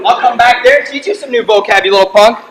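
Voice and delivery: loud mock threat, slowing at the end. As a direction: bark it at the heckler. Threatening a heckler.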